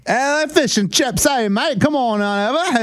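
a lightly mocked British accent; bad British accent. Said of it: "What does a British guy sound like?".

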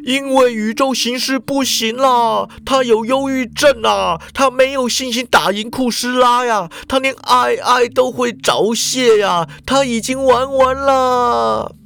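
pained outburst, dragging the last words; venting cry. A narrator's reading. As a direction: let it all out in despair. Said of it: "发泄似的叫道".

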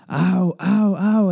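put-on boy faking pain under the matron's fingers, high yelps. A narrator's reading. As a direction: cry out sharply as if prodded. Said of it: "I cried".

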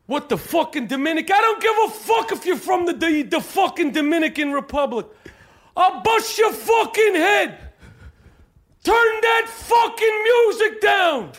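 high, strained yelling in a comic rage; hammed-up angry tirade; furious shouted rant. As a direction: scream threats over blaring music. Acted rant at bodega worker.

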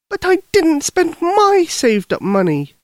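put-on high, eager young girl; little girl voice. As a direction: speak like a worried little girl. Lucy protests.

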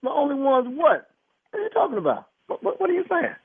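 high-pitched, incredulous questioning; real bewilderment. Real, not acted. Husband questioning the doctor's hint, incredulous. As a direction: demand an explanation in disbelief.

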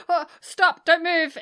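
a rookie cop calling stop, a bit too loud; green lady cop shouting. Retold or imitated. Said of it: "she's like".

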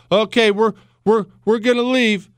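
hammed-up nervous promise to leave; mock flustered retreat. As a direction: stammer like a cornered official backing out. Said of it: "They say".

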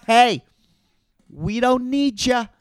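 ordinary talk, nothing marked

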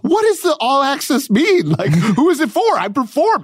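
high-pitched exasperated squawk; retold outrage, voice way up high; indignant protest. Performer barred backstage arguing with guard, indignant. Acted row with guard over access.

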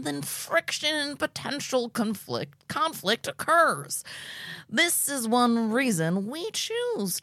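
slow, high preachy cult leader; Glenody voice. Acted. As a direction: read it slow and lofty like a guru. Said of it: "What do you think Glenody would sound like".

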